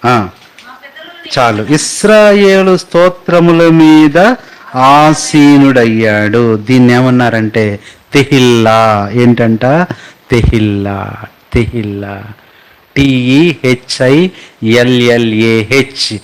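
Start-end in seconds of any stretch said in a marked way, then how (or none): 1.6-6.6 slow, loud proclamation; solemn scripture reading. Shown as a demonstration. Pastor reads Psalm twenty-two, slow and loud. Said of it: "చూడండి, కీర్తన". 13.0-16.2 slow letter-by-letter spelling of టీఈహెచ్ఐఎల్ఎల్ఏహెచ్. Shown as a demonstration. Pastor spells tehillah for the congregation, slowly. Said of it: "Tehelka అనమాకండి".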